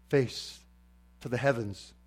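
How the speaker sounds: ordinary talk, nothing marked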